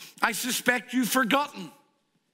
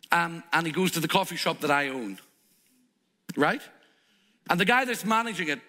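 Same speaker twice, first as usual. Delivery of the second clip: normal talking voice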